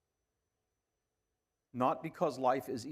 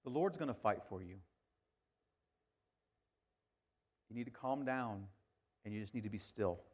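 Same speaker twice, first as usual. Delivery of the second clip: much softer, calming hush; soft reassuring; 9 dB quieter, faster than usual. Retold or imitated. Moses soothing the panicking Israelites, soft and reassuring. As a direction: settle them down gently and softly.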